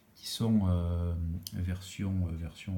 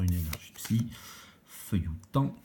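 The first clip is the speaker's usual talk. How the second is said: usual speaking voice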